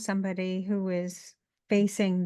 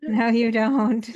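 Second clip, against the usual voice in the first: laughing; 3 semitones higher, 7 dB louder, slower than usual. Real, not acted. Teasing back, laughing.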